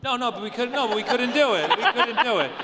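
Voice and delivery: high, loud, laughing denial; laughing protest. Real, not acted. Writer laughingly protesting that it was not about money, loud and high. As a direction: push back hard, half laughing.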